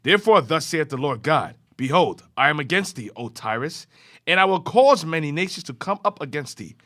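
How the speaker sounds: ordinary talk, nothing marked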